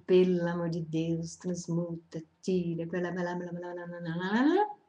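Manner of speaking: low, soft monotone rattle of prayer; droning mock prayer trailing into nonsense; mock praying. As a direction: imitate rote pleading that runs into babble. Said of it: "eu comecei a rezar".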